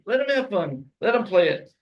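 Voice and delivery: ordinary talk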